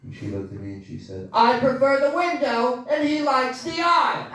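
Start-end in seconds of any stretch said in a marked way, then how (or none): 1.3-4.2 wife's reply. Retold or imitated. Wife's curt reply, voiced higher.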